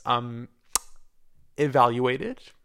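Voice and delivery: ordinary talk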